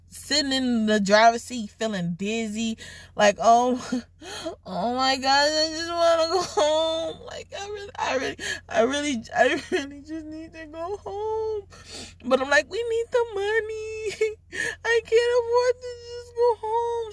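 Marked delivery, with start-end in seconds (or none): 3.4-11.6 high, teary whine; mock sobbing, wanting to go home; high-pitched half-cry; whiny crying. Retelling breakdown in car. 12.7-17.1 high, teary whine; mock sobbing, wanting to go home; high-pitched half-cry; whiny crying. Crying about needing money.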